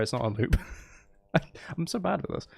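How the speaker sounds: ordinary delivery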